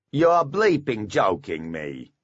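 slow, grumpy dwarf voice; belligerent dwarf. A narrator's reading. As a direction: push back like a stubborn dwarf. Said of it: "belly thrust out belligerently".

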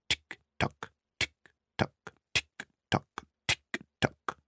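soft repeated clock-tick imitation; whispered tick-tock. A narrator's reading. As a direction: tick steadily like a quiet clock. Mimicking the timer mechanism.

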